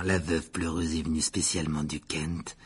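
low, soft ghostly gentleman; proud host. A narrator's reading. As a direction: confide it proudly, dropping low and quiet. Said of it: "dit Nick fièrement".

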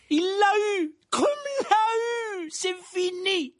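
very high, drawn-out shouts; slow, soaring excited yells; exclaiming. A narrator's reading. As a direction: cry out the catch in disbelief. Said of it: "s'exclama Harry".

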